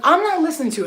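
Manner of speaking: loud, high-pitched retold refusal; defiant refusal. Driver refusing the manager's order to pull out, defiant. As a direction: refuse loudly, pitch jumping up.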